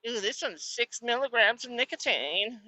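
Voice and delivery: high, soft prissy mock voice. Mocking nicotine pouch users, prissy mock voice. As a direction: mimic a prissy pouch user.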